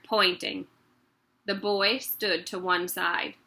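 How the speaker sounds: ordinary delivery, nothing marked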